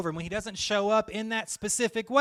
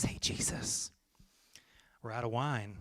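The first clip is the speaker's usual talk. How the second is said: hushed whisper, much softer; discreet whisper; 8 semitones lower, 10 dB quieter than usual. Acted. Groomsman quietly telling Jesus the wine ran out, whispered. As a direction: lean in and whisper it so nobody hears.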